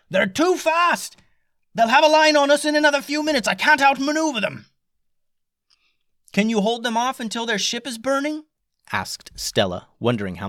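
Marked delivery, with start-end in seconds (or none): 0.1-4.7 high, loud alarmed shout; panicked captain's yell. A narrator's reading. Captain shouting down that the pirates are too fast, panicked. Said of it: "The captain yelled down". 6.3-8.5 urgent question. A narrator's reading. Stella asking captain urgently.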